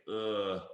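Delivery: low groan of distaste; a deflated, low ugh; uninspired "ugh". Acted. Coach reacting to "should", deflated groan.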